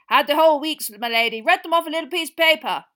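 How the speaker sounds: loud, hearty boasting; pleased with his own diligence; proud knight. Acted. Sir Cadogan continues proudly.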